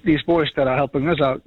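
normal talking voice

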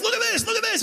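high, eager urging to friends; can't wait to show them; excited showing-off. Acted. Showing his friends the apps.

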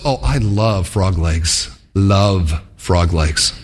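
low, slow, dreamy craving; a lightly voiced fish, low and drawn out; longing fish heart. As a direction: savour each word like a hungry fish. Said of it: "the heart goes".

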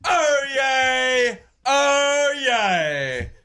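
long, drawn-out crier's call; town crier. Acted. Host announcing himself as official town crier, grand slow proclamation. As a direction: proclaim your own title, stretching every word. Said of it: "allowed us to be the town crier".

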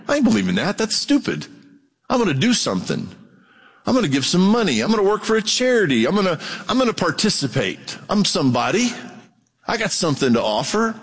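high-pitched, self-important refusal; mock defiance. Preacher mimics someone rejecting free salvation, mock defiant.

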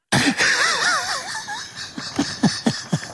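very high soft squeal of laughter. Real, not acted. Host giggling about the nerdy producer. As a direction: let out a tiny high squeal.

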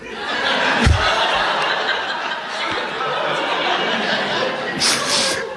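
high breathless laughing fit; helpless laughter. Real, not acted. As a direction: laugh until you can't breathe.

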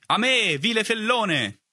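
high, drawn-out knightly cry; shouted challenge. A narrator's reading. Older boy challenging l'Aviron from behind, high mock-chivalrous cry. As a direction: call out the villain theatrically. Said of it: "gridò una voce alle mie spalle".

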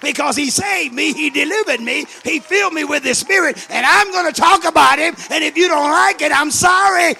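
loud, high, mounting sermon cry; full-force preaching. Real, not acted. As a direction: build into an impassioned shouted testimony.

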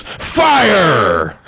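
high, loud punchline shout; shouted at the top of lungs. Retold or imitated. Deacon blurting the fatal word, full mock shout. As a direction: shout it as loud as you can. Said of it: "yelled out at the top of his lungs".

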